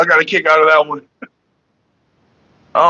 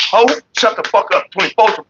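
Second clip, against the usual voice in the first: usual speaking voice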